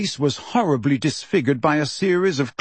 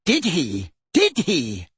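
relishing the news; pleased, appreciative repetition; 3 semitones higher, slower than usual. A narrator's reading. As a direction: savour it appreciatively. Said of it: "said Fudge appreciatively".

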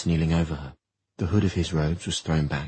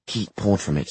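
ordinary delivery

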